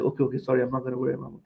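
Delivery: low, soft mock apology; imitated meek compliance. Imitating Muslims giving in to demands, meekly apologetic. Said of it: "We're sucking up to everything".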